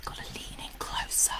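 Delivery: very soft secretive whisper; conspiratorial whisper. Pretend. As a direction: whisper to draw the listener close. Inviting viewer into secret club.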